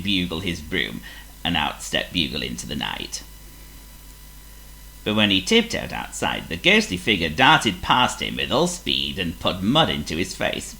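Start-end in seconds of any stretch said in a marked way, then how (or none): none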